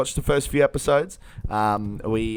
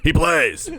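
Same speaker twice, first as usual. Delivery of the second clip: put-on catchphrase voice, pitched up; guy with the voice; 4 semitones higher than usual. Host quoting a character to amuse co-host, put-on voice. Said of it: "that guy with the voice".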